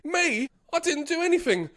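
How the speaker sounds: high, fast whiny protest; protesting innocence. Retold or imitated.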